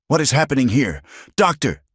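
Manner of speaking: high, alarmed shouting; a doctor arriving at a fire and demanding answers; shouted. Acted. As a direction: call out across the burning yard, high and urgent. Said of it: "Aris shouted".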